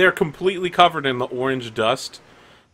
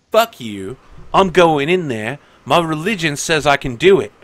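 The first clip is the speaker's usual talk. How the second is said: defiant threat; 5 dB louder than usual. Acted. NPC threatens the guard.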